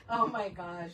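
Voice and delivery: ordinary talk, nothing marked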